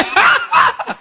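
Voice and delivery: very high rapid cackle; mock high-pitched laughter; imitated answering laugh. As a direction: laugh back in high ha-has. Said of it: "and you say".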